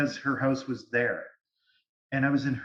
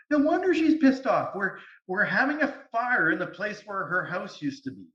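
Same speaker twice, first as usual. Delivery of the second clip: incredulous realisation; 7 semitones higher than usual. Retold or imitated. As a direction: cry it out in amused disbelief. Realising the fire is on her house.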